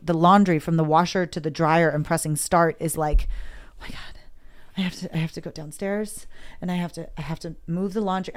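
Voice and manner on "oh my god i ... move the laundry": breathless whispered dread, sinking softer. Retold or imitated. Woman voicing her anxious inner thoughts about laundry, hushed dread. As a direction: whisper it like the chore is a mountain.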